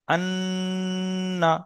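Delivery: আন্না with a long, level nasal nn; ghunnah demonstration. Shown as a demonstration. Teacher demonstrates obligatory ghunnah, long held nn in আন্না. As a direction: hold the doubled n of আন্না as a slow hum. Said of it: "নুনের উপরে তাসদিদ গুন্না ওয়াজিব".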